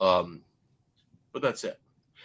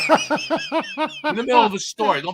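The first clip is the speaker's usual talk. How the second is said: laughing; 12 semitones higher, 5 dB louder than usual. Real, not acted. Host to co-host, a scolding broken by laughter. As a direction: scold him while cracking up.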